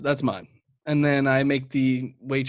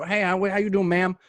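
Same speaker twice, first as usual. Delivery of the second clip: lightly put-on Southern accent, raised pitch; 6 semitones higher than usual. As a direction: greet her with a hint of Southern twang. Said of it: "I gave him a Southern accent".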